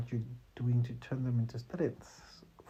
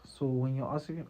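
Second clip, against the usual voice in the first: usual speaking voice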